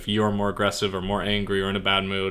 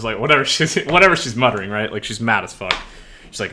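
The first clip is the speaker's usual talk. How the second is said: ordinary talk, nothing marked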